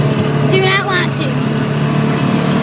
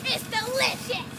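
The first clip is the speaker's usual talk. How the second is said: softer infomercial pitch; ad read; 16 dB quieter than usual. Pretend. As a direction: sell it like a TV ad. Mock commercial ad read.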